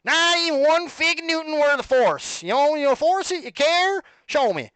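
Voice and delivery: high, loud, slow cowboy holler; hammed-up cowboy yelling out a challenge. Shown as a demonstration. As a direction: holler it like an over-the-top cowboy. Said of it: "Read it in, like, a cowboy voice".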